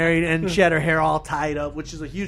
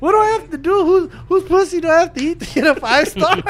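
high whiny outrage; 11 semitones higher, 4 dB louder than usual. Acted. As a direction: whine it way up high. Mock outrage at low rating.

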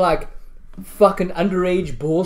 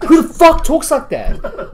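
high, drawn-out incredulous complaint; exasperated disbelief; 9 semitones higher, 4 dB louder, slower than usual. Retold or imitated. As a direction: scold a terrible actress in disbelief. Retold scolding of the bad acting.

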